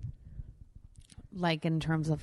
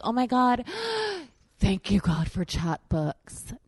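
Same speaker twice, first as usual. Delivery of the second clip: mock relief; 5 semitones higher, 3 dB louder than usual. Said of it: "And you're like".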